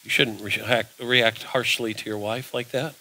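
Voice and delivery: low, soft voice of conscience; quiet Holy Spirit voice. Retold or imitated. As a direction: hush it down like an inner nudge. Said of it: "the Holy Spirit will say".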